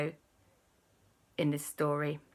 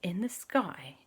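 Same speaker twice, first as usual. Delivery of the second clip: slow, soft, whispery reveal; hushed wonder; 3 semitones lower, 6 dB quieter, slower than usual. A narrator's reading. Storyteller reveals something strange in the sky, hushed wonder. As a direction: let each phrase hang in suspense. Suspense before the rainbow.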